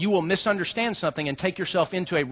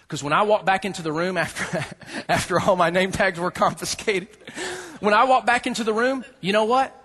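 ordinary talk

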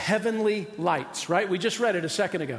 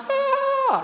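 sudden high-pitched mock scream; inner scream; 4 dB louder than usual. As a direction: let out a scared inner shriek. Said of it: "inside they're going".

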